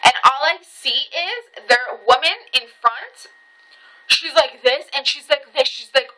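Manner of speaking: ordinary delivery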